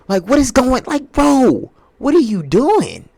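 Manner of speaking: high, loud outburst; exasperated yelling. Real, not acted. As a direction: yell at the player in disbelief. Yelling at LeBron over lost bet.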